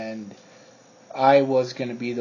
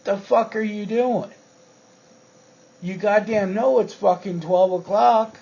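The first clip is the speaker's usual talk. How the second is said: higher, louder mock yell at a coworker; retold angry yell; 7 semitones higher, 5 dB louder than usual. Yelling at the trainer back late from buying weed, mock angry. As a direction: chew out the stoned trainer, half-yelling. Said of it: "I was just tired and fucking yelling".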